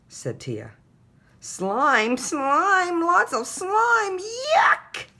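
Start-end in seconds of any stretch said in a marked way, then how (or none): 1.4-5.1 put-on high, loud kid's yell of disgust. A narrator's reading. Little brother yells at his sister after a slimy hug, high loud yell. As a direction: yell it out like a grossed-out little boy. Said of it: "yelled Tate".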